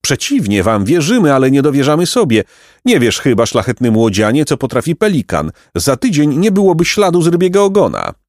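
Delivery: high, animated pompous bird; high-pitched, self-important pelican; boastful pelican. A narrator's reading. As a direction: brag grandly about a bottomless appetite. Story figure, boastful pelican.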